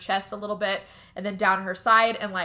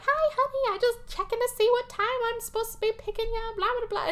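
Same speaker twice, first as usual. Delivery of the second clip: much higher, fast, chirpy mom voice; faster than usual. Retold or imitated. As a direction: rattle it off like a bubbly mother. Mom on the phone.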